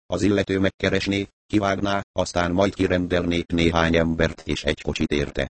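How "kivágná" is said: usual speaking voice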